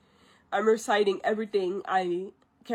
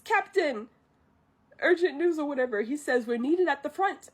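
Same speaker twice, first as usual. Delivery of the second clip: higher, pressing report; urgent Chifu report; 6 semitones higher, 4 dB louder than usual. Retold or imitated. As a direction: burst in with the news. Chifu rushes in with news.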